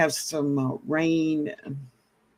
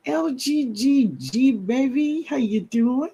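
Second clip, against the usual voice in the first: bright, higher-pitched call out to a friend; higher, lilting shout-out to a viewer; high sing-song greeting; 8 semitones higher than usual. Real, not acted. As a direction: sing the hello up high and playful.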